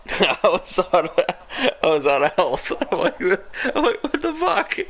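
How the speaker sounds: high, helpless laughing speech; laughing hard. Real, not acted. Recounting being wrecked in a race, cracking up. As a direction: squeal it through real laughter.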